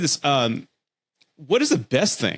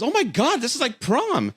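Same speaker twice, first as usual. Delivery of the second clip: squealing mock girl voice, much higher; imitating excited date; 12 semitones higher than usual. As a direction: gush like a thrilled teen going to prom. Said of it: "She goes".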